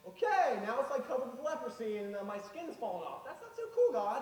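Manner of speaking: high, loud panicky yelp; panicked. Acted. Moses yelping to God about his leprous hand, panicked and high. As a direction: freak out at your own skin falling off.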